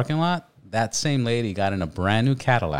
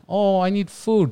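mock beggar voice; 11 semitones higher, slower than usual. Mocking a homeless beggar.

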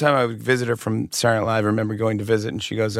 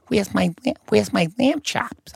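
imitating mom; 10 semitones higher than usual. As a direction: demand the lamb chops up high. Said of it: "She's like".

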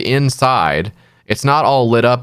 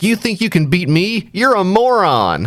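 mock villain taunt; 8 semitones higher than usual. Villain taunting Harley after she misses, hammed-up high sneer. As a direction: sneer like a cartoon bad guy.

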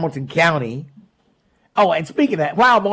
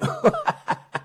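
soft laugh; 6 dB quieter than usual. Real, not acted. Laughs at own joke.

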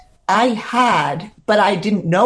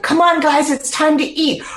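put-on higher, upbeat call to the girls; excited rallying; 7 semitones higher than usual. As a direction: cheer the group on toward the meal. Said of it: "I was excited".

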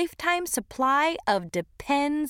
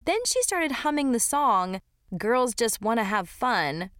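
ordinary delivery

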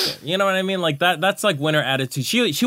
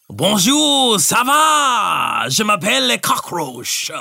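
loud hammed-up French accent; 5 semitones higher, 4 dB louder, slower than usual. Auditioning on a dare, a loud cartoon French accent. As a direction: belt out a cartoon French greeting. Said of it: "at least audition for the part?".

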